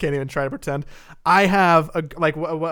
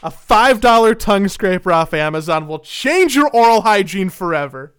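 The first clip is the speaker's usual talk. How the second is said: loud, high sales pitch; car salesman; 4 semitones higher, 8 dB louder than usual. Pretend. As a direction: sell it hard. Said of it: "you just sound like a car salesman".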